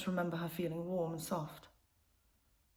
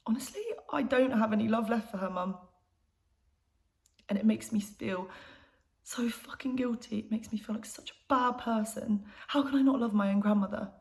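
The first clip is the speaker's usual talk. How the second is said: upset, guilt-ridden outburst, pitched up; anguished confession; 4 semitones higher, 3 dB louder than usual. Acted. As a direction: confess it in distress, building to a louder plea. Guilty outburst to her mum.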